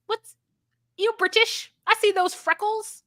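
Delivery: high-pitched put-on British lady voice; mock British accent. Retelling Kathleen greeting a stranger, high mock British accent. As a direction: ham up a chatty British woman. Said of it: "she was like".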